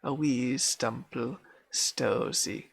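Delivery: nursery rhyme recited in broad Scots; Scots accent. Acted. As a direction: put on a Scottish accent, calling out the lively lines. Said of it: "this is gonna be an accent".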